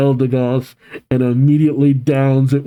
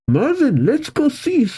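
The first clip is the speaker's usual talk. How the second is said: high, perky and eager; bubbly, pitched-up girl character; excited; 10 semitones higher than usual. Acted. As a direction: chirp it to a friend. Casey excitedly leads friend off.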